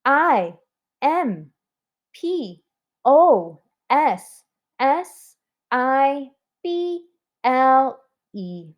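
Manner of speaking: slow, letter-by-letter spelling aloud. Shown as a demonstration. Teacher spelling always for the class, slow letter by letter. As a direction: space out each letter for the class. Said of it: "And now let's spell it".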